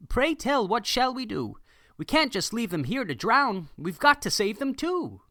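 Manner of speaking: a worried captain begging for help; pleading captain. Acted. Captain pleading with the circus boss to save animals.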